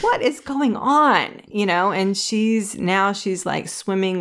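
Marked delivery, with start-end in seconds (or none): 0.0-1.4 higher, louder, slow what is going on; mock bewildered. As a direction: stretch it out in disbelief. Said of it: "she was like".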